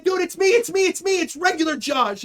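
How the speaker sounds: high, urgent repeated protest; acted-out scramble to explain; panicked pleading. Acting out pleading after being attacked.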